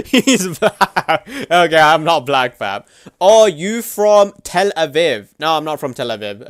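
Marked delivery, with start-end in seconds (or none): 0.0-1.3 high laughing repeat. Real, not acted. Streamer laughing at chat's joke. 3.2-5.3 slow, higher, louder mocking read; mock question. Streamer mockingly reading a viewer's question.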